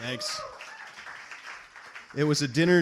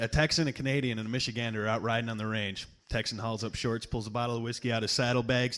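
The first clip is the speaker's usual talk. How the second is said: ordinary talk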